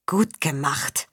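slow, soft, strained mutter; forced out. A narrator's reading. Zinder to Faun after the failed bluff, forced out. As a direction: squeeze it out under your breath. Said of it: "presste Zinder hervor".

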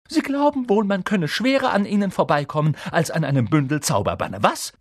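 high-pitched, raging teacher; furious. A narrator's reading. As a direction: lose your temper at the pupils. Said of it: "wütete sie".

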